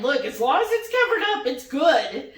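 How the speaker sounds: usual speaking voice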